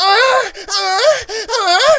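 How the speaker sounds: loud high fake moans; mock moaning. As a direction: moan like a porn ad. Imitating a loud porn ad.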